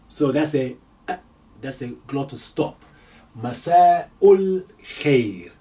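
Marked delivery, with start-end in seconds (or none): 3.4-5.5 Masa'ul Khair slowed right down and louder; slow model greeting. Shown as a demonstration. Teacher drilling the evening greeting, slow and louder. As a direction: say Masa'ul Khair slowly for repetition. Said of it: "modelling greeting slowly".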